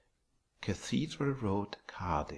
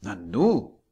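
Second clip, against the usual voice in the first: exclaiming; 11 dB louder than usual. A narrator's reading. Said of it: "rief er".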